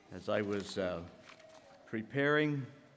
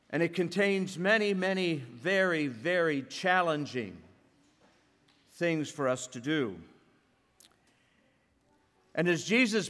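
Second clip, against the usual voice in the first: higher, louder preaching voice; 5 semitones higher, 4 dB louder than usual. Acted. Pastor urging the congregation on the Beatitudes, full preaching force. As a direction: drive the point home from the pulpit.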